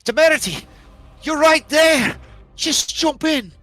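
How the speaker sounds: high, loud pleading shout in a put-on voice; a little gnome begging her hero at the top of her lungs; Macy shouting. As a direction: cry out to her to jump in, pleading. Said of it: "she shouts out to you".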